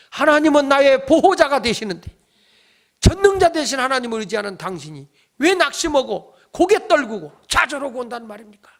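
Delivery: acted reproach, high and loud; impassioned rebuke. Luther's wife rebuking her disheartened husband, impassioned rebuke.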